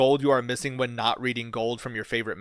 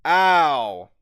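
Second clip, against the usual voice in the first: pained ow; 3 semitones higher, 9 dB louder than usual. Real, not acted. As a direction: wince it out as the sting hits. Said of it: "I bit the inside of my cheek".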